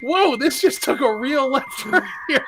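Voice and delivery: high, stunned mock outburst; shocked disbelief. Host reacts to the chatbot's fingers story in high mock shock. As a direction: act floored by the chatbot's turn. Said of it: "I'm just like".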